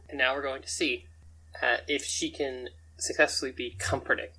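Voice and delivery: ordinary talk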